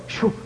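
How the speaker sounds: short rushing whoosh; chariot whoosh. Retold or imitated. Preacher likening chariots to circus rides, whooshing. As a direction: imitate a ride zipping by. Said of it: "I heard the chariot go".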